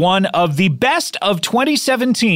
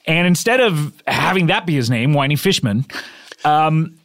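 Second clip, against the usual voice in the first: normal talking voice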